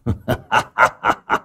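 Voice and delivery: acted villain's "ha ha" laugh; staccato villainous laughter; hearty mock cackle. A narrator's reading. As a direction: laugh out coldly in bursts. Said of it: "cười ha hả".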